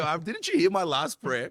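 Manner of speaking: high, loud, drawn-out comic complaint; mock protest. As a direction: plead in exaggerated disbelief.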